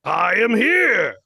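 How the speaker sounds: big, slow, booming declaration; booming hero shout. Acted. Impostor repeats catchphrase.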